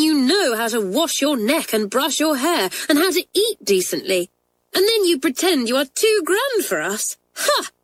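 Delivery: high-pitched indignant tirade; raging. A narrator's reading. Janet berating a schoolmate about manners, raging. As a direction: rail scornfully, pitch shooting up high. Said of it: "raged Janet, flinging down her book again".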